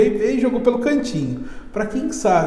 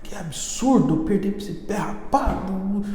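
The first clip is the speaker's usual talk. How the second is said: hurt, sulking king; 6 dB quieter than usual. Retold or imitated. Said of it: "meio chateado, magoado".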